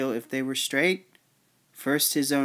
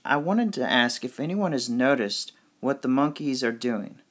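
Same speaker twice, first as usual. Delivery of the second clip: ordinary delivery, nothing marked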